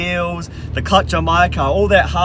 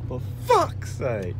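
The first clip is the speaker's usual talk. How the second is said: slow weary exasperation; 5 semitones lower, 9 dB quieter, much slower than usual. Real, not acted. Exasperated after car trouble.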